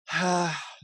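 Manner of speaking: long weary exhale; acted heavy sigh of defeat; resigned sigh. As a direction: sigh as if let down by your own people. Retold weary reaction to series.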